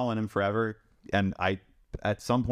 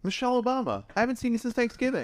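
much higher pitched pretend greeting; mock celebrity greeting. Pretending to greet Michelle Obama, mock-familiar high voice. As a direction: play-act greeting a long-lost famous friend, high-pitched.

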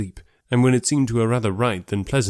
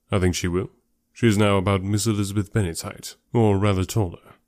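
measured, understated answer; Darcy; 2 semitones lower than usual. Acted. Darcy answering about his sister's height, reserved.

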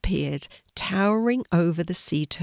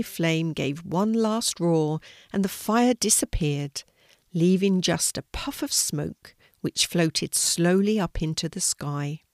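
ordinary delivery

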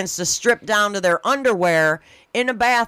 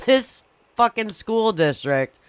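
normal talking voice

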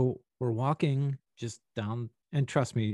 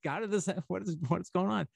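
baffled; 7 semitones higher, 3 dB quieter than usual. Retold or imitated. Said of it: "I'm like".

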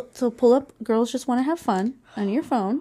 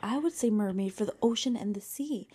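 whispering; 6 dB quieter than usual. Real, not acted. Keeping quiet at bedtime.

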